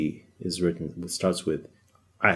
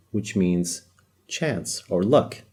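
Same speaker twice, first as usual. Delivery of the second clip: usual speaking voice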